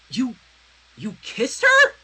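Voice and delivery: loud, high cry of shock; shouted. A narrator's reading. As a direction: shout it out, hurt and stunned. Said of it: "Twilight shouted".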